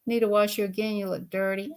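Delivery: ordinary talk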